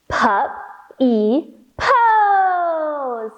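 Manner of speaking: very slow, sing-song bouncing words; bouncy stretched puppy pose. Shown as a demonstration. Teacher bounces the kids through puppy pose, very slow sing-song. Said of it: "Try it again".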